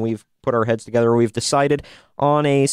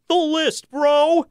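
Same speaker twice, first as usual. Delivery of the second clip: high-pitched bro; slower than usual. Acted. Catchphrase after the list.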